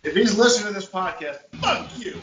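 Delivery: loud, angry call-out; loud, fed-up curse at the ex-date. Real, not acted. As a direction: tell him off loudly. Angry message to bad date.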